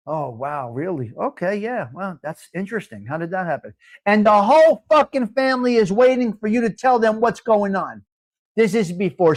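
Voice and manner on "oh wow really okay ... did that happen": hushed phone reply. Retold or imitated. Listening on phone, family waiting.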